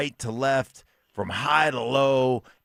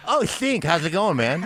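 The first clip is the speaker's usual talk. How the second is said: high, laughing coach voice; cheerful old coach greeting; Lou Holtz friendly; 7 semitones higher, 5 dB louder than usual. Retold or imitated. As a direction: switch to sudden friendliness. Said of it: "And he goes".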